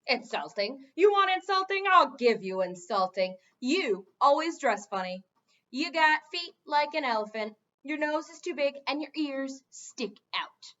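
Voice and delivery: loud, angry tirade of insults; furious insults. A narrator's reading. Gargoyle insulting the king, furious shouting. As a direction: hurl every insult you have.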